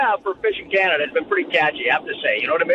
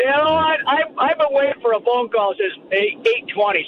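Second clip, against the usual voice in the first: higher, playfully huffy complaint; mock indignant rant; 7 semitones higher than usual. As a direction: fake grievance, teasing the host. Mock rant about waiting.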